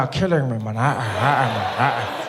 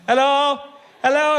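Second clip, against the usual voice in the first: calling out; 7 semitones higher, 3 dB louder than usual. Acted. Calling from car window.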